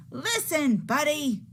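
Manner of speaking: loud call, pitched high; yelling. Acted. Parents yelling at Buddy to listen, loud call. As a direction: yell the name to get his attention. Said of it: "His parents tried yelling".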